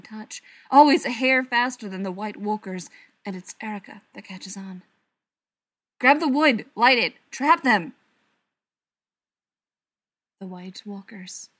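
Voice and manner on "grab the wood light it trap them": loud, higher-pitched urgent commands; urgent shout to rally the pack; shouted orders. A narrator's reading. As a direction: call out battle orders. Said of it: "orders falling from his mouth".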